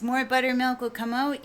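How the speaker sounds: ordinary talk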